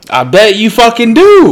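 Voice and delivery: high, loud disbelieving jab; loud retort. Real, not acted. Yelling back at his friend about hospital water. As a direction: shout it back mockingly.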